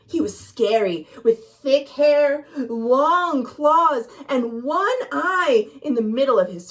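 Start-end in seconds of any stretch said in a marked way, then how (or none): none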